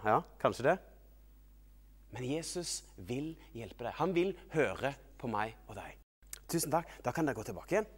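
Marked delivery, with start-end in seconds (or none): none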